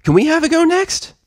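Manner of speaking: high, loud excited filly voice; bursting to try next; eager little filly; thrilled filly. Acted. Scootaloo thrilled after crash.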